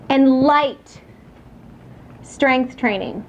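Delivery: light leaned on, drawn out slowly; stress on light. Shown as a demonstration. Trainer stressing light weights to the audience, drawn out. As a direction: lean on the word light and slow down after it. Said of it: "Notice how I'm emphasizing light".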